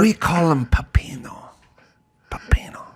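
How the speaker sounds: whispered imitation of her accent saying "pepino"; imitating the wife's Spanish accent; mock Spanish accent. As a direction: breathe "pepino" like a secret. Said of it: "her accent".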